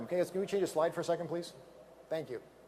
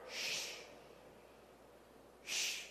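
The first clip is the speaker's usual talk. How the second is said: quiet drawn-out shush to the crowd; gentle hushing sound; soft shush; 19 dB quieter than usual. Shown as a demonstration. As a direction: hush them like a secret. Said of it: "hushing the room".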